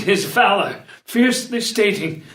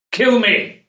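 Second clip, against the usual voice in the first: higher, sudden cry of surrender; desperate plea; 5 semitones higher, 4 dB louder than usual. Acted. Counselor prostrate at the prince's feet, desperate plea. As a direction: cry it out, offering your life.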